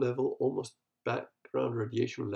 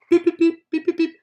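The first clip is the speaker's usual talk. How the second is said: high, loud sung beeps like a news intro; mock news jingle beeps; 12 dB louder than usual. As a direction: beep out a broadcast jingle, much higher.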